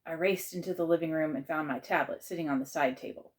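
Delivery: ordinary delivery, nothing marked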